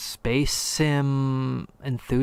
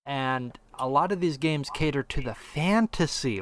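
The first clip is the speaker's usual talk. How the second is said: ordinary talk, nothing marked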